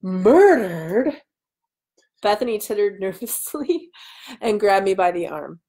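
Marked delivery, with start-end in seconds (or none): 0.0-1.2 gasped. A narrator's reading. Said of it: "and I gasped".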